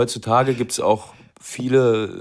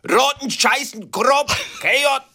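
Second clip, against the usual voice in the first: much higher, slow mock German gibberish; mock harsh German; slower than usual. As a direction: parody harsh-sounding German. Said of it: "die machen nur Tonalität".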